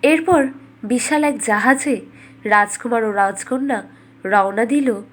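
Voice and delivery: ordinary delivery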